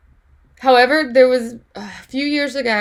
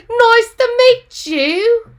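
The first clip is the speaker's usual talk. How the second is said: high, very slow fancy voice; mock posh; 11 semitones higher, 7 dB louder, much slower than usual. Teacher mocking a formal greeting, high and drawn out. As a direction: drag it out like a prim aristocrat.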